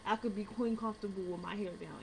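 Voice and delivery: normal talking voice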